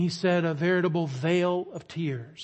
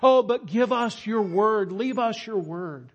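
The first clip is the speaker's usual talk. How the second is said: higher, louder desperate begging; pleading with God; 6 semitones higher, 4 dB louder than usual. Retold or imitated. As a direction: beg the Lord not to take his word. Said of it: "began to play the part of the congregation, pleading with the Lord".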